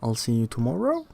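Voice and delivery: "tomorrow" rising at the end, quick; rising question tune. Shown as a demonstration. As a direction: ask it, lift "tomorrow" up. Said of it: "with a rise in pitch on the final word".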